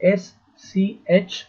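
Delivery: letter by letter, long gaps; slow spelling dictation. Real, not acted. Teacher spelling a name for students, slow dictation. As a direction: spell it out very slowly for copying.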